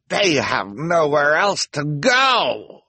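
high, slow, ringing shout; loud cry. A narrator's reading. Officer pointing at the terrified children, shouting. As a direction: stretch it out loud for the whole mob.

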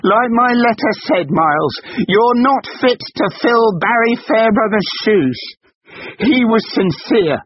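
very high, loud, slow, stressing NOT; slow furious shout. Acted. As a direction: drive each word home. Wife repeats insult slowly.

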